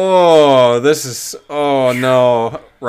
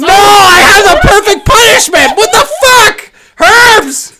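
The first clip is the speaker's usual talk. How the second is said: very high, loud yell; shouting; 9 dB louder, slower than usual. Real, not acted. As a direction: yell over everyone. Uproar over punishment.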